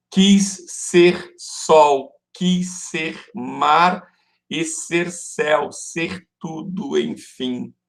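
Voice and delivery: slow, measured scansion, each word set apart; syllable-by-syllable verse. Shown as a demonstration. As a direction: recite beat by beat, gradually softening to the last word. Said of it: "aumentando o número de sílabas poéticas".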